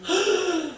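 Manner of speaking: sharp, high intake of breath; demonstrated gasp of stunned frustration; speechless gasp. Shown as a demonstration. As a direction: gasp as if struck dumb. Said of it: "너무 답답해가지고 말문이 막힐 때가 있죠".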